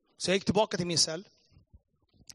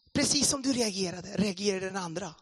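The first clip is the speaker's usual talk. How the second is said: higher, emphatic retold exclamation; emphatic reply; 5 semitones higher than usual. Pastor answering the indignant listener, emphatic and animated. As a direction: burst out with the point of the story.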